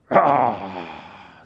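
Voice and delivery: loud, low rasping "ah" of rage; breathy growl of fury, trailing away; enraged snort. Acted. Preacher mimicking furious disciples, acted angry roar. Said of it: "The disciples were going".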